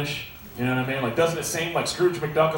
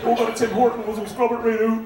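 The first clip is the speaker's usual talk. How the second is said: mock Canadian; 7 semitones higher than usual. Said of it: "I hear you Canadian sympathizers".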